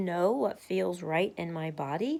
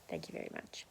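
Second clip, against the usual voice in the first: sarcastic whisper; 16 dB quieter, faster than usual. Real, not acted. Sarcastic aside after complaint.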